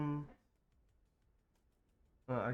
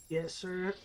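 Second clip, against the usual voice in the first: voice cracking; 6 semitones higher than usual. Real, not acted. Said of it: "my voice is".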